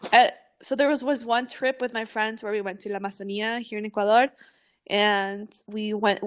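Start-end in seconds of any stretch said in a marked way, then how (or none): none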